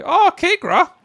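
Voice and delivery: sudden loud startled cry; surprised shout. Real, not acted. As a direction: blurt it out in shock. Teammate just died in game.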